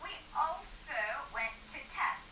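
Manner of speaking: soft hushed whisper; barely above a whisper. Shown as a demonstration. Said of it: "as a bedtime story".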